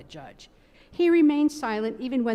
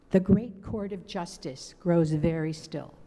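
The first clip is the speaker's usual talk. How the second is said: plain reading voice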